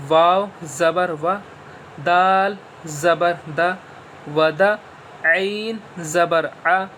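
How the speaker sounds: slow, syllable by syllable letter drill; slow spelling drill. Shown as a demonstration. Teacher drilling zabar letters for pupils, slow and deliberate. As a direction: spell each letter out slowly for children. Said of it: "اسی طریقے سے ہم اسے پڑھ سکتے ہیں".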